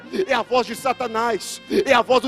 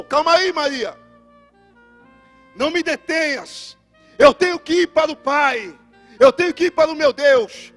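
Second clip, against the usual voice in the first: acted halting plea; 3 semitones higher, 6 dB louder than usual. Christ stopping Mary from embracing him, raised declaiming voice. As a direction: hold her off with spaced, ringing phrases.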